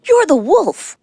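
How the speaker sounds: loud, high alarmed shout; shouting goat. A narrator's reading. Little goat unmasks the wolf, loud shout. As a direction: yell it out in alarm. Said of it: "shouted a little goat".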